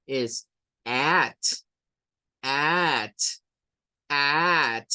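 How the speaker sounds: "at" with a short open ae, the T closed off in one go; "at" as in English, ae straight into a crisp T. Shown as a demonstration. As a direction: clip the T shut on "at". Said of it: "esto es at y sacamos la T de una sola vez".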